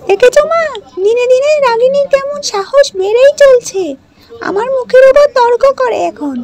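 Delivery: high, loud, sneering stepsister voice; spiteful stepsister. Acted. Stepsister scolding Ragini over the dress, high and loud. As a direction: jeer at her, shrill and loud.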